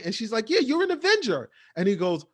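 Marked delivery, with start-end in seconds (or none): 0.5-1.5 eager. Retold or imitated. Agnes eager.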